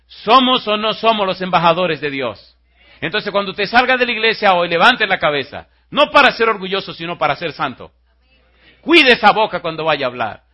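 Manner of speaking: loud, high shouted exhortation; full-force preaching. Acted. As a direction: preach it out to the whole church.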